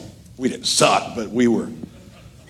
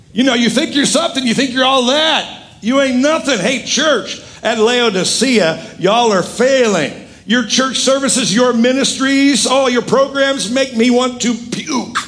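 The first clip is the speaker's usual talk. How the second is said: high-pitched, emphatic rebuke; fired-up preacher voicing a rebuke; preached scolding; 8 semitones higher than usual. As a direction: tell the church off from the pulpit, voice raised high. Voices Jesus scolding Laodicea.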